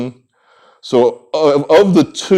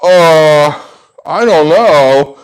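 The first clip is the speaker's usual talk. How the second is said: slow, unsure mumble, a little louder; hesitant subject; 5 semitones higher, 5 dB louder, much slower than usual. Retold or imitated. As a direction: hedge uneasily, dragging the words. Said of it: "the subjects are there like".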